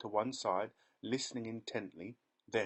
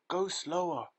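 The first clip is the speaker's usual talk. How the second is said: panting plea; 8 semitones higher, 6 dB louder than usual. A narrator's reading. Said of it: "panted Missus Salt".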